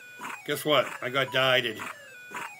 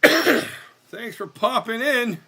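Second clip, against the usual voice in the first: drawn-out thanks; 10 semitones higher, 7 dB louder, much slower than usual. Pretend. Drawn-out repeat of greeting.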